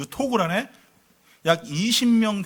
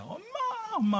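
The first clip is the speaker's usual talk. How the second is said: imitated child's scream; 5 dB quieter than usual. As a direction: act out a frightened child calling. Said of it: "소리를 지르니까".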